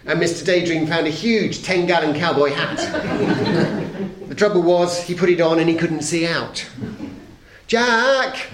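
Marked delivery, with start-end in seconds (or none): 2.8-4.3 a short, breathy chuckle; airy chuckle. A narrator's reading. Laugh at oversized hat. 7.7-8.5 calling out. A narrator's reading. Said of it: "he called from under the hat".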